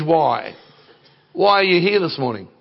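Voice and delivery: ordinary delivery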